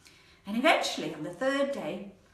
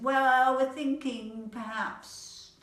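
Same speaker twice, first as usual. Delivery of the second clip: loud, high "well", then dragged out slowly; hesitant; 6 semitones higher, 6 dB louder, much slower than usual. Acted. Animals asked about their plan, hesitant. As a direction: stall with long, stretched words.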